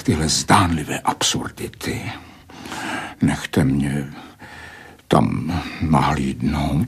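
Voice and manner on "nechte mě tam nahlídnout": slow, breathy plea to be let in; pleading whisper. Acted. Man begs Marcela to let him look, whispered. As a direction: beg softly to take a look.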